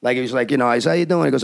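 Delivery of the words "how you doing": higher, cocky greeting in imitation; mock swagger. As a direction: mimic an overconfident writer breezing in. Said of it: "with his swagger and his crap".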